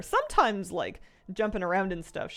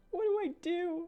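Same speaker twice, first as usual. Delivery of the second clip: lifted, puzzled dog voice; high dog voice; 10 semitones higher than usual. Retold or imitated. Owner voicing her jealous dog, high and puzzled. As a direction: squeak it as a confused dog. Repeats dog's line higher.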